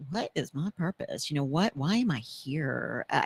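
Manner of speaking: usual speaking voice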